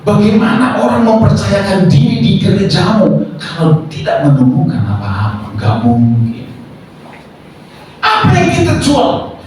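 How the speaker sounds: high-pitched rhetorical challenge; impassioned preaching. Real, not acted. Preacher asking the church what it truly offers, impassioned preaching. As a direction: launch each question high, like a preacher pressing the crowd.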